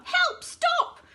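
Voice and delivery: very high, loud, flustered cries; tumbling crocodile crying for help; dazed crocodile panic. A narrator's reading. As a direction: squeal in confusion. Crocodile knocked over, dazed.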